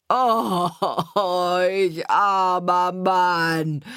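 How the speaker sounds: loud, very drawn-out sobbing wail; wailing lament. A narrator's reading. Carter whose third horse is dead, long tearful wail. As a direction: howl it slowly in hammed-up despair. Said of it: "rief er".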